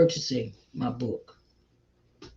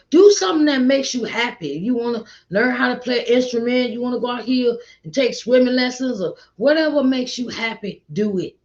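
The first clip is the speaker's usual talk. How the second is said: excited encouragement; 8 semitones higher, 4 dB louder than usual. Real, not acted.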